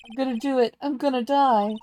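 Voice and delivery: high, loud despairing wail; wailing client. Acted. Voicing Larry screaming in court, high loud wail. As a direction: wail it like a man at the end.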